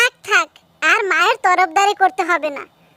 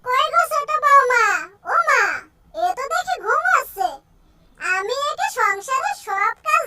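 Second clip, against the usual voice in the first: put-on higher, scolding old mother-in-law voice; 5 semitones higher, 5 dB quieter than usual. Mother-in-law scolding the sleeping daughter-in-law, old scolding voice.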